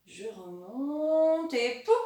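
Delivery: slow, stretched climb ending on a popped pouf; drawn-out stroke narration. Shown as a demonstration. Teacher tracing the letter upwards, drawn out then popped. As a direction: stretch the word as the pen climbs, then land it.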